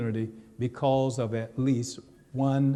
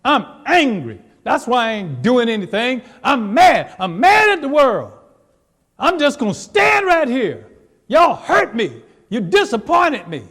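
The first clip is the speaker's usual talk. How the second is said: much higher, much louder angry rant; angry shout; 10 semitones higher, 12 dB louder than usual. Acted. Angry person voiced by the preacher, shouting at the world. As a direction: shout it like someone mad at the world.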